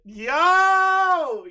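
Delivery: very high, loud exclamation; shocked yell. Real, not acted. Streamer reacting to the shocking question with a yell. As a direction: blurt it out in disbelief.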